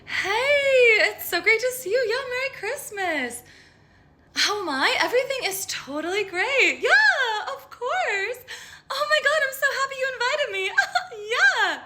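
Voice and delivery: very high, loud, gushing and laughing; shrill-sounding fake delight greeting relatives; fake cheery party voice. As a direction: squeal it out like someone faking happiness at a holiday party. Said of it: "High-pitched volume getting higher".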